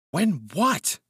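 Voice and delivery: high-pitched startled demand; astonished. A narrator's reading. Hawberk questioning his cousin's outburst, astonished. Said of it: "demanded Hawberk, astonished".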